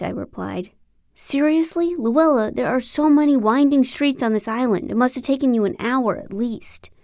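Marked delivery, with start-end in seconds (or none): 1.1-6.9 higher, disbelieving "Seriously?"; amazed she walked all that way, pitched up; incredulous. Acted.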